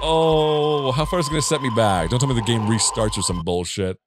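normal talking voice

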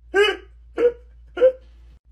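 loud, high shrieking laugh. Acted. Student shrieking with laughter in class.

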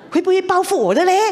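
worried question. Real, not acted. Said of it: "我心里很怕哦".

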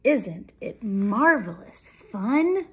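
loud, slow, swooping delight; thrilled storybook mouse; gleeful exclamation. A narrator's reading. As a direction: stretch the big words with relish. Thrilled mouse in noisy ride.

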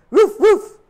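very high, loud barks; dog barking. A narrator's reading. Dog barking in the distance. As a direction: bark like a dog. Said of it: "a dog barking".

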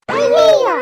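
woman shocked at who hit the car; startled ayyayyo. Acted. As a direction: shriek it in sudden fright.

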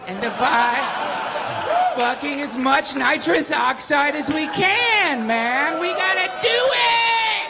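mock revolutionary yell, rising high and loud; laughing, shouting rally cry. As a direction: whip up the crowd, hammed up.

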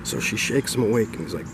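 ordinary delivery

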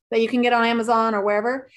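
usual speaking voice

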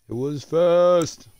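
high, slow, stretched voice; drawn-out silly voice. Pretend. Repeats line in drawn-out voice.

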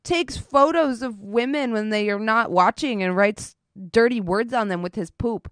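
ordinary talk, nothing marked

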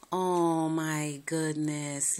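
ordinary talk, nothing marked

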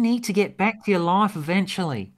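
put-on high, lively Australian accent. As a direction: play Tegan with an Australian accent. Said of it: "I can actually do an Australian accent".

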